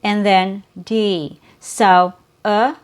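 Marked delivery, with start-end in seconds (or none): none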